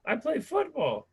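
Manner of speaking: high, slow hammed-up voice; mock realization. Husband realizing what his wife's mouth smells like, high and slow. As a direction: play a sudden gross realization. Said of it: "and I was like".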